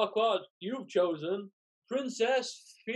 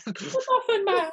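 squeaky gingerbread man; 12 semitones higher, 3 dB louder than usual. Acted. Gingerbread man taunting Farquaad.

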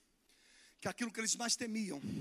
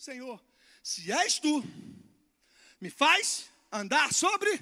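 slow, louder, rising plea; bold plea; 4 semitones higher, 8 dB louder, much slower than usual. Acted. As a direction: ask boldly and slowly. Pedido ousado de Pedro. (pedido ousado, lento e mais alto)

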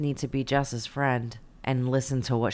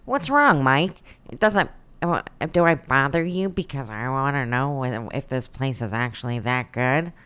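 mock nerd voice. S1 voicing the nerd mockingly.

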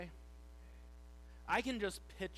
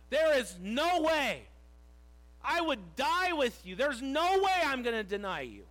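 loud, high-pitched adamant vow; 6 semitones higher, 8 dB louder than usual. Acted. Peter swearing he would die with Jesus, adamant protest. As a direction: insist at full volume, stretching the key words. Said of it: "He was adamant".

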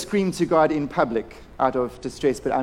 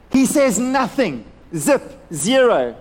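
"nothing" slow and pitched high, then "Zip" and "zero" set apart; slow, punched "nothing", "Zip", "zero"; emphatic; 7 semitones higher, 5 dB louder, slower than usual. Real, not acted. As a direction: hammer "nothing", "Zip", "zero" as separate blows. Preacher stressing God's silence.

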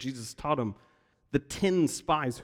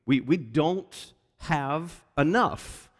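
drawn out; 3 semitones higher, 4 dB louder, much slower than usual. Retold or imitated. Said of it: "is their response".

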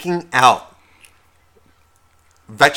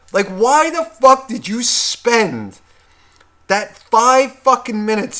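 angry rant; 6 semitones higher, 5 dB louder than usual. Retold or imitated. Husband's angry rant at wife.

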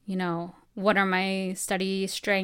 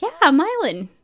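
much higher, bright imitation of a friendly mentor; imitating Cicely; 7 semitones higher, 6 dB louder than usual. Cicely pointing out fellow Navajo classmate, higher imitating voice. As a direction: chirp it like an eager older student. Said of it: "I remember Cicely was like".

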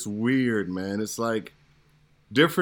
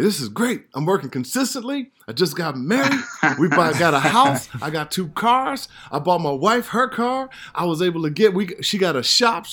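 higher, cheery listing of wins; mock proud bragging; 6 semitones higher than usual. Actor recalling early success to hosts, proudly bragging. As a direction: brag about your new life, half-joking. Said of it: "And I'm like".

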